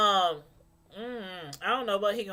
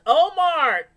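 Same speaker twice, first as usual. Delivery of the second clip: a loud laugh into the name Omar; laughing greeting; 5 semitones higher, 11 dB louder than usual. Real, not acted. Laughing as she greets viewer Omar in chat.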